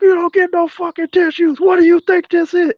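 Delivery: high, loud, hammed-up drill sergeant yell; imitated sergeant yelling at the crying girl; mock sergeant yell. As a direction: scream it like a drill sergeant denying tissues. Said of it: "So he's like".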